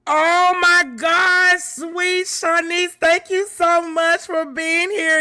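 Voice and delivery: high, slow gushing thanks; overjoyed. Real, not acted. Streamer thanks a viewer for coming, overjoyed. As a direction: gush with real delight.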